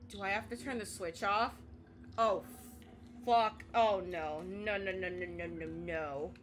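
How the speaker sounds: ordinary talk, nothing marked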